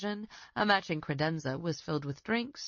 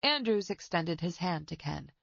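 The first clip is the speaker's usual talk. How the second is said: ordinary delivery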